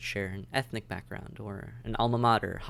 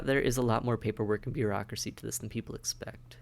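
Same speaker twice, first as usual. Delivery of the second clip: ordinary talk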